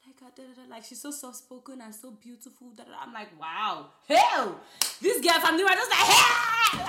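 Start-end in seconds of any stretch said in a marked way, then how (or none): 0.1-3.0 very soft, hushed voice; soft-spoken voice. Retold or imitated. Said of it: "even the way she talks". 3.4-6.8 loud ratchet shout. Pretend. Loud ratchet self-contrast.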